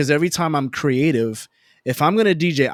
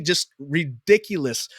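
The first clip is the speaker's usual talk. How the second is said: ordinary delivery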